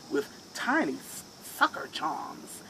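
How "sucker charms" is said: plain reading voice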